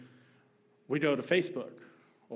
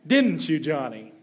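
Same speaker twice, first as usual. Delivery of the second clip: loud, teasing sing-song jab; sly taunt; 2 semitones higher, 10 dB louder than usual. Retold or imitated. Sister teasing her brother Johnny, loud sly taunt. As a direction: needle him sweetly, she knows his secret.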